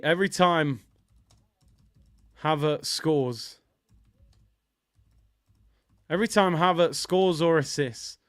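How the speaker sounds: halting dictation with long pauses; composing a tweet aloud. Real, not acted. Streamer types a tweet about Havertz, halting dictation. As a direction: say it piece by piece as you type, firm and loud. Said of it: "I'm gonna tweet".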